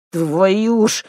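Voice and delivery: panting from running; puffing. Acted. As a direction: puff it out mid-sprint. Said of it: "пропыхтел он". (пыхтит на бегу)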